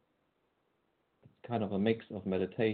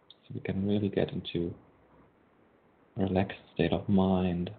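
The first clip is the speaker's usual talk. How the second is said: guided meditation. Acted. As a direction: ease listeners into calm.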